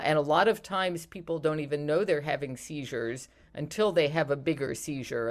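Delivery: usual speaking voice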